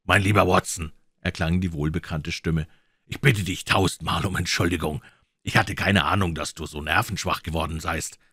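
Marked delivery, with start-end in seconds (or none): none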